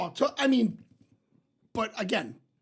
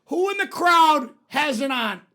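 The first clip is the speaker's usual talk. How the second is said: louder, slower showman call to the crowd; mock stage psychic; 5 semitones higher, 5 dB louder, slower than usual. Psychic working the crowd, mock showman call. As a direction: announce it slowly to the audience. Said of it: "She's like".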